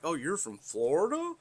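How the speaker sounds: high, rising imitation of a curious stranger; mock intrigued surprise. Stranger asking the Southerner about home, mock intrigued. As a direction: mimic an impressed question, lifting sharply at the end. Mimics people asking where he's from.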